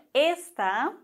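Esta with stress on the first syllable, ÉS-ta; ésta, stressed E. Shown as a demonstration. Teacher repeating ÉS-ta for German learners. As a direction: hit the E in Esta. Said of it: "mit dem Akzent auf dem E".

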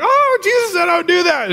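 high, loud mock wail; distraught Peter. Peter realizing Jesus foretold his denial, hammed-up distraught wail.

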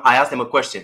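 usual speaking voice

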